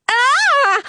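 acted high-pitched shriek; startled, drawn-out "ah" shriek. A narrator's reading. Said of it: "I shriek".

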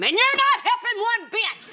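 sudden high, loud yell; furious outburst, voice leaping up; exploding in anger. Acted. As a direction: blow your top at him. Temper explodes at ex-husband.

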